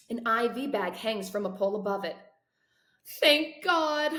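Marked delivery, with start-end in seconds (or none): 3.2-4.1 high, loud cry of relief; emotional relief. Acted. Mother sees her son awake, a loud relieved cry. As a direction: let it burst out, overcome. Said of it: "choked with emotion".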